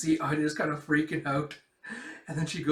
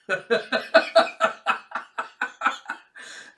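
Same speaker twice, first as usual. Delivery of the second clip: high, loud run of laughs; real laughter; 9 semitones higher, 6 dB louder than usual. Real, not acted. Storyteller amused by the father's reaction, real laughter. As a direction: crack up mid-story.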